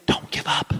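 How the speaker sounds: hushed, voiceless whisper; soft whispered urging; whispered encouragement. Acted. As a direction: whisper it like an inner voice. Voice he hears encouraging him.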